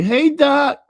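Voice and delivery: high, bright put-on hello; fake friendly greeting. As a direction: smile and greet him falsely. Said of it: "But they gonna be".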